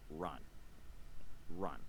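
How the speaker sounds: deep quiet warning to the audience; urgent warning. Real, not acted. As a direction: drop into a grave low warning. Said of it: "Because if someone is this emotionally fragile".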